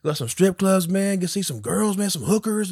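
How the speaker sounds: pushy driver impression. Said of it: "pushy, pushy".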